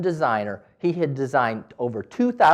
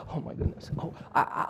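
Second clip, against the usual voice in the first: breathless whispered exclamation; whispered amazement; 10 dB quieter than usual. Real, not acted. To Gertrude about her painting, whispered amazement. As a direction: gasp it under your breath.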